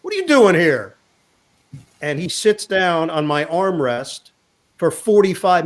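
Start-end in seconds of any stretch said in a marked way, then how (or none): 0.0-0.9 high, startled whoa of recognition; surprised teammate spotting an old friend on the train; startled surprise. Retold or imitated. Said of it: "He's like".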